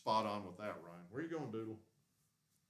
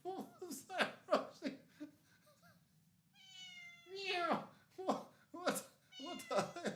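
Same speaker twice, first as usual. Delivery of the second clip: very high, louder startled cat talk; pet voice; 4 dB louder, slower than usual. Real, not acted. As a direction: squeal at the cat. Surprised by the cat.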